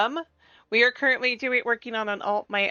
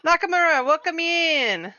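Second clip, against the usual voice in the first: excited greeting; 8 semitones higher, 4 dB louder than usual. Real, not acted. Greeting a viewer by name.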